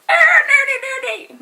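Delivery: hammed-up high squeal of nudie; squeaky mock teasing voice; silly high mock voice. Teasing banter after sign-off.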